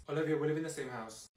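normal talking voice

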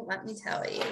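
softly whispered, rising at the end; whispered aside. Real, not acted. Telling the audience about bad days, whispered aside. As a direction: drop to a confiding whisper.

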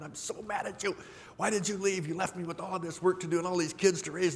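high, strained voice of someone just bereaved, mad and disbelieving; angry denial of a widow, pitched well up; angry grief. Retold or imitated. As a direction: cry out high at the one who left you. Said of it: "There might even be anger".

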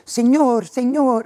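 high calling voice, Senhor stretched; calling the passenger. Retold or imitated. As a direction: call out to the passenger. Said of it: "veio uma venezuelana".

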